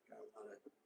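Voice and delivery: breathy aside under his breath; whisper. Real, not acted. Streamer whispering an aside to chat. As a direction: drop to a whisper mid-thought. Muttering to himself mid-sentence.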